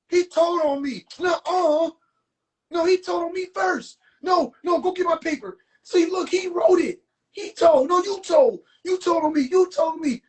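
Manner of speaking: put-on high, whiny back-and-forth of kids blaming; mock childish squabble. Mimicking a childish argument over who snitched. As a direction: play two kids bickering over who told.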